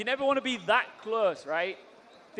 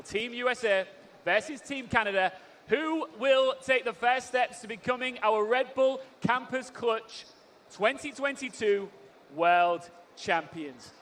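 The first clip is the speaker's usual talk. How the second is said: slow, drawn-out hype announcer; frantic mock sports commentary; 4 semitones higher, slower than usual. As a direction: stretch out the title for the crowd. Host hyping the grand final.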